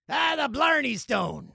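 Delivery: high, slow, loud fake Irish; bad Irish accent. Irish host attempts his heritage, badly faked Irish accent. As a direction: overdo a failed Irish accent. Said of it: "I can't even do an Irish accent".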